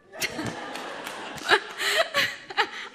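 high, loud, long laugh; real laughing fit. Real, not acted. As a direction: crack up helplessly at your own story. Cracks up telling story.

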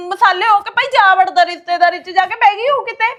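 loud, crying rant at her husband; loud wounded fury, near sobbing; tearful furious outburst. Acted. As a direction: shout it through tears of rage. Wife rages at husband over father.

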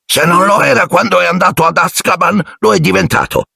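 high put-on character voice, deliberate; a slow-spoken driver, pitched well up; slow-voiced driver. A narrator's reading. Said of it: "con la sua voce lenta".

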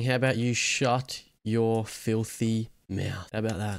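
slow, drawn-out menacing taunt. Acted. Player slowly taunting the trooper to shut up. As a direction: stretch each word to provoke.

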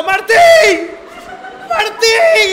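loud high shout of a name; shouting loudly and high to wake a brother; calling out. Retold or imitated. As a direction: yell the name to wake him. Waking his brother in panic.

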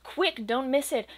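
plain reading voice

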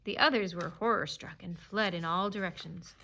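ordinary delivery, nothing marked

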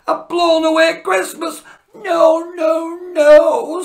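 loud, high wailing no; despairing cry. Acted. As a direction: cry out in dismay as the presents fly. Santa despairs loudly.

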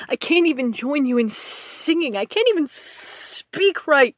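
building from upset to a high, loud cry; frustrated partner spilling everything he has lost, climbing into a loud anguished cry; anguished outburst. Acted. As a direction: let despair break out, rising.

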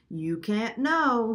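slow, high bird voice; a parrot croaking it out slowly. Acted. Lena the parrot speaks slowly.

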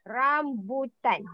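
Rambutan drawn out syllable by syllable; slow model pronunciation. Shown as a demonstration. Teacher modelling a fruit name for the class, slow pronunciation. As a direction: say Rambutan slowly for learners to copy. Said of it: "to pronounce it".

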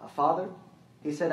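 ordinary delivery

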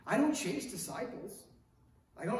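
ordinary delivery

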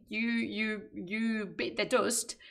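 usual speaking voice

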